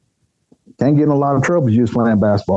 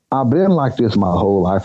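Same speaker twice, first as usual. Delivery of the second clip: ordinary talk, nothing marked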